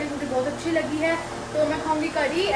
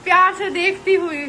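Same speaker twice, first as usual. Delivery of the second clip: higher and louder playful teasing; teasing excitement; 7 semitones higher, 7 dB louder than usual. Real, not acted. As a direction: tease mom fondly for the camera. Filming mom, teasing her affectionately.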